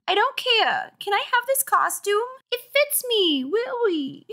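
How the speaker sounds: put-on very high, babyish insistence; squeaky small-boy pleading; little brother voice. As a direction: beg like a stubborn tot. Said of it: "This is his voice".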